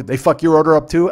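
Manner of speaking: loud, aggressive re-enacted question; pissed off. Big pissed customer asking a stranger about his order, loud and angry. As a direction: blurt it out loudly, as if fuming. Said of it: "I'm standing there fucking pissed".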